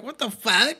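retold outburst of disbelief; imagined outrage. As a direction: play the affronted actor. Imagined outraged actor at premiere.

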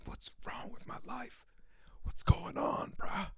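hushed, breathless whisper of misery; whispered despair. Retold or imitated. Talking to himself over chapped lips, a despairing whisper. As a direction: whisper it like life is falling apart.

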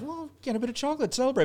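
chirpy excuse for a reward; gleeful mock celebration; treating yourself. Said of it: "I'm like".

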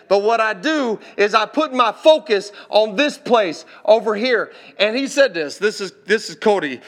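loud, high preacher's delivery; impassioned preaching. Retold or imitated. Preaches a retold testimony.

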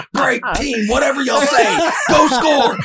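high, loud laughing coach; mock coach shout.

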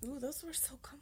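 soft unvoiced whisper. Real, not acted. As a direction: breathe it out in a whisper.

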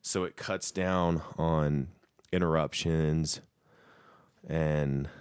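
ordinary delivery

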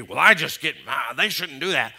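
loud indignant complaint; mock angry. Acting out an angry person objecting to sinners, mock angry. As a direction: grumble loudly like an offended churchgoer. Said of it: "instead of being angry".

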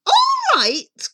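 high, loud outburst; indignant cry. Acted. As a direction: throw it back as a high cry. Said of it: "cried Cindy".

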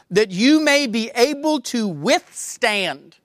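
withstand drawn out slow and leaned on; stress on withstand. Shown as a demonstration. Preacher reading the verse to the church, stress on withstand. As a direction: lean hard on the word withstand while reading scripture. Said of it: "the emphasis that I put on a certain word".

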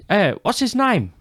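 high, slow Cockney impression; drawn-out, high-pitched mock Cockney question. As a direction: ask it as a cartoonishly high Cockney. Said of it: "This Cockney English guy?".

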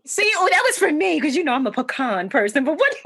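ordinary talk, nothing marked

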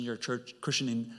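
normal talking voice

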